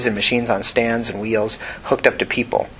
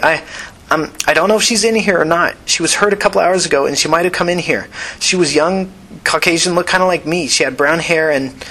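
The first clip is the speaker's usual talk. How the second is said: nervous; 6 semitones higher than usual. Acted. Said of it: "Tina started nervously".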